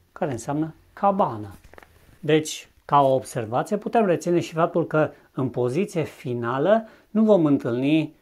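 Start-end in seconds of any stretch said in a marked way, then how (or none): none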